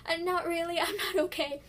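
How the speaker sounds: half-joking teary denial; retold teary reply. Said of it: "And I was like".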